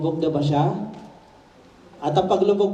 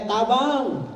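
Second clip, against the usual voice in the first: Bisaya cry; 4 semitones higher than usual. Retold or imitated. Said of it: "Sabi ng mga Bisaya".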